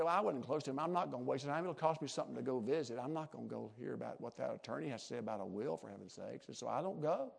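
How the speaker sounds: low, quiet, offhand imagined refusal; dismissive excuse. Retold or imitated. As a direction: shrug it off in a lightly acted quote. Voices heir refusing an inheritance.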